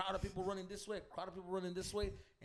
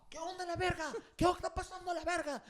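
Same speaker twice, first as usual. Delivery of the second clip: high, loud demand; pitched-up imitation of a challenger; mock confrontation; 13 semitones higher, 6 dB louder than usual. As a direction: burst in as the angry newcomer. Newcomer bursting in on fight.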